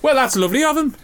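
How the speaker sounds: a quick Scouse lilt on a kind remark; Scouse accent. Pretend. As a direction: say it like a Liverpudlian, lightly. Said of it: "slipped into some kind of Scouse accent there".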